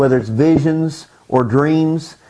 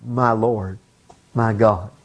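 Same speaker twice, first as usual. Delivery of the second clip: hushed, low awe; soft and low, with absolute honor; reverent; 7 semitones lower, 5 dB quieter, slower than usual. Acted. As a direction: address your Lord in deep reverence, voice dropped. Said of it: "There was absolute reverence, absolute honor".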